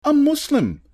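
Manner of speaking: high-pitched urgent calls; short, high cries from onlookers; crowd shouts. A narrator's reading. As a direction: shout over a commotion to defend her. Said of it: "came the shouts of others".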